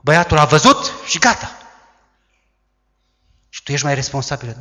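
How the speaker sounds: normal talking voice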